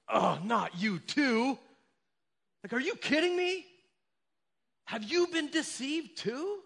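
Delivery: high, slow, scornful disbelief; scoffing Pharisees. Acted. Pharisees to their officers, high mocking disbelief. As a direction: sneer it out like a shocked elitist. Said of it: "are like".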